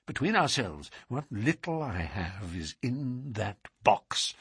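soft, slowing, secretive; hushed confidence. A narrator's reading. Patient confides in detective about his money, hushed voice. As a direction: drop the voice to confide.

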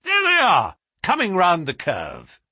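high, loud call, easing down; excited cry. Acted. Holmes follows the race through field glass, crying out. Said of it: "he cried suddenly".

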